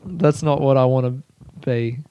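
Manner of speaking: normal talking voice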